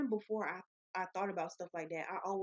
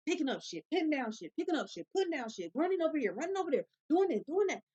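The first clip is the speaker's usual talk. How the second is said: building, higher and louder listing; frantic rant; 7 semitones higher, 4 dB louder than usual. Real, not acted. Worker describing hectic shifts, building frantic rant. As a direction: pile it on faster and louder.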